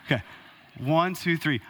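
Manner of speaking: ordinary talk, nothing marked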